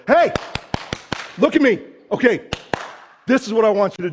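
sharp, loud coach getting your attention; coach calling out. Retold or imitated. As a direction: snap him to attention like a coach who cares. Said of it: "have you ever had a coach".

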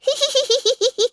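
very high, rapid monkey chattering. A narrator's reading. Monkey springs from the book beside the girl, chattering. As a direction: chatter shrilly like an excited monkey. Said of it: "chattered the creature".

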